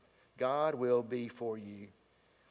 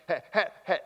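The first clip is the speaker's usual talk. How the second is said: normal talking voice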